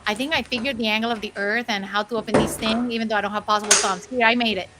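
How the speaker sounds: normal talking voice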